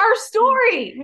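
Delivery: loud, very high, drawn-out thrilled cry; excited squeal. Real, not acted. Co-host revealing a celebrity viewed their story, excited squeal. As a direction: squeal the big news, stretching it out.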